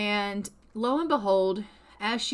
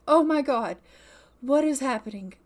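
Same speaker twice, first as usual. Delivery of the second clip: acted panic, louder with short urgent bursts; freaking out; 3 semitones higher, 5 dB louder than usual. Lost woman fleeing a wolf, acted freak-out. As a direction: play a frantic outcry without real screaming. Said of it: "she's crying and screaming and freaking out".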